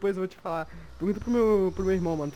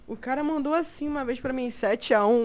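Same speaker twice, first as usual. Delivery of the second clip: ordinary talk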